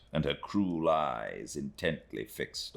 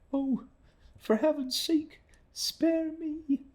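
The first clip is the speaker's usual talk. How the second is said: put-on much higher, pleading young woman's voice; pleading exclamation; slower than usual. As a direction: beg for mercy in a high, fragile young woman's voice. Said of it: "exclaimed Emily".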